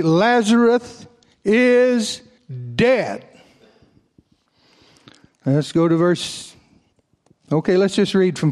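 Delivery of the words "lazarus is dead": IS held long and high, then a pause before DEAD; slow, plain announcement. Acted. Preacher reads Jesus telling the disciples plainly, slow and emphatic. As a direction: spell out LAZARUS IS, higher and slow, then drop DEAD. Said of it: "Jesus said to them plainly".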